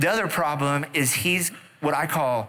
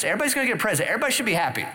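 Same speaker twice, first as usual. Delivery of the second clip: excited; 7 semitones higher, much faster than usual. Real, not acted. Christmas comparison, building excitement.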